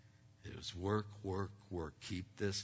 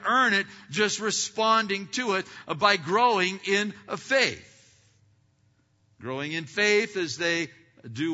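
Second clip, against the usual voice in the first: loud, higher, emphatic pulpit delivery with long stressed words; preaching at full force; 10 semitones higher, 13 dB louder than usual. Acted. Pastor exhorting his church on the work of faith, full-voiced preaching. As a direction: drive each point home to the congregation.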